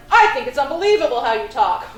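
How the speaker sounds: loud, indignant mock old-man scolding; outraged. Livid old man scolding the clerk, loud indignant. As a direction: scold the clerk for his language.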